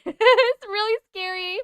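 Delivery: high, drawn-out scared squeal; mock scared. Streamer tells viewers about creepy footage, mock scared squeal. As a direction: stretch it high in playful fright.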